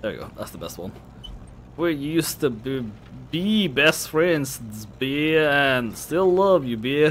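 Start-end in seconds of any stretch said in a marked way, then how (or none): 1.8-7.1 put-on slow, higher drunken drawl; sloppy drunk girl. Drunk girl to her old best friend, gushing drawl. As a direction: stretch the words in a sentimental drunk slur. Voices drunk character in game.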